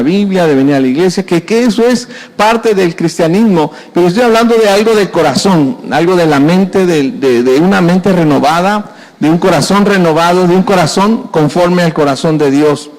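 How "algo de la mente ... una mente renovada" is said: ordinary talk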